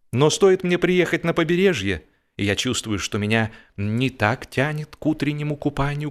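plain reading voice

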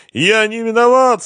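high, loud whimpering wail; desperate high-pitched whine; whining. A narrator's reading. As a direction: whine it like a whipped dog. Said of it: "скулил Семён".

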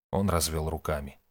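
plain reading voice

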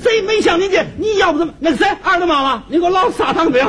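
high-pitched irate holler to the wife; hammed-up husband bellowing for dinner; calling out. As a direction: shout an order across the house.

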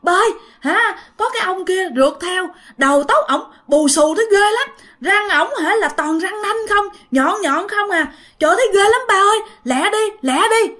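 high, scared pleading to father; frightened child. Acted. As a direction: beg dad to hurry, high and frightened. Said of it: "nó mới sợ".

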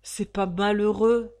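put-on a young lion roaring his protest; thundering voice. Young prisoner thunders his indignation through the van. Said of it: "tonna une jeune voix léonine".

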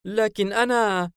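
high, eager voice, drawn out slowly; enthusiastic. A narrator's reading. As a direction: burst in eagerly, high-pitched, stretching the words. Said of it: "ليفياثان بحماس لفكرة المتجر". (بحماس وصوت عالي الطبقة)

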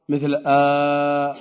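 long drawn-out "آ"; long vowel demo. Shown as a demonstration. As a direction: stretch the "آ" sound slowly. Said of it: "يعني أحرف لها صوت". (صوت "آ" ممدود ببطء)

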